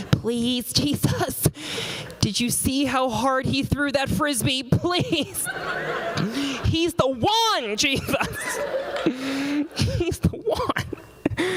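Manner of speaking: high, drawn-out swooning plea; mock desperate prayer, begging Jesus in a high voice; lovestruck camper praying. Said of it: "pray that Jesus will let you get married".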